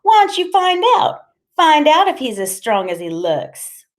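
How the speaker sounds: very high, loud, teasing woman; playful goading, starting high and sliding down; strange giggly voice. A narrator's reading. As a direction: egg him on in a high, giggly squeal. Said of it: "in a strange giggly voice".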